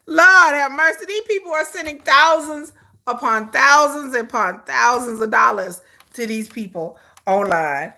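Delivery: ordinary talk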